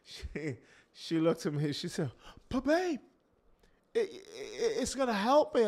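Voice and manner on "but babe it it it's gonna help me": higher, pleading mock wife voice. Preacher voicing his wife begging him, higher pleading. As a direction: beg for it like his wife would.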